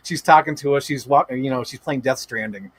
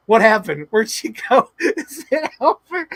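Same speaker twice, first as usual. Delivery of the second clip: high, louder panicked questions, breaking into laughter; mock freaking out; 7 semitones higher, 5 dB louder than usual. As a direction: imitate a chat in a panic. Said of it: "freaking out".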